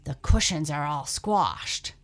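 loud growl of complaint; Mama Bear growling over her chair. Acted. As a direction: growl it like a cross mother bear. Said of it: "growled Mama Bear".

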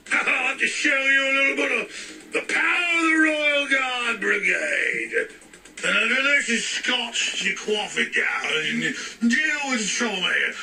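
a hammed-up English copper's accent, barking orders; bad English accent. Mock English guard shooing off a Yank, in an English accent. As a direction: play a pompous English royal guard in a shaky English accent. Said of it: "I'm shifting into my Englishness for a moment".

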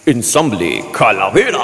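slow, drawn-out promo read; announcer voice. Acted. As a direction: sell it like a concert ad. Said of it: "déjame te hago el anuncio que vamos a hacer".

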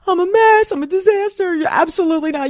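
high, tearful protest; distraught pleading. Retold or imitated. To boyfriend in bed, high tearful pleading. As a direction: plead that you're a mess. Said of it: "I tell him".

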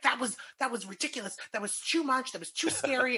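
a hint of a high whiny complaint; lightly voiced upset customer; complaining woman. Woman to security guard, mildly whiny complaint.

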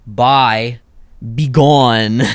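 loud, forceful mock dismissal; dismissive banishing. Shown as a demonstration. Guest joking how he doesn't say bye, loud banishing. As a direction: send them away with a booming bye. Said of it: "I'm not like".

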